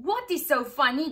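loud, fast high goose question; curious goose. A narrator's reading. Goose asking the others what's funny, loud and quick. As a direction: honk the question eagerly. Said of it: "the goose wanted to know".

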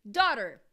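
"Daughter" run together as almost one syllable, loud; loud American "Daughter", squashed into one beat; nearly one syllable. Shown as a demonstration. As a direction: punch out "Daughter" as a single beat. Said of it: "eu diria até uma só".

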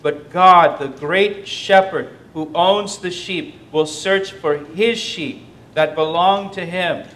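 But God burst out loud, then slow; emphatic preaching. Acted. Preacher contrasting hirelings with God, loud then slow.

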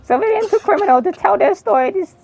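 radio host. Acted. Opens his radio show.

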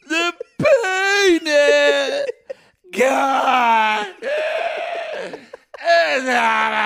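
drawn-out mock sob of relief; hammed-up sobbing. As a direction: wail it out like a melodramatic breakdown. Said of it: "And scene".

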